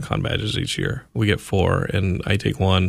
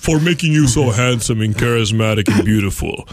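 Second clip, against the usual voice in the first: booming God voice. Pretend. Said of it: "God was like".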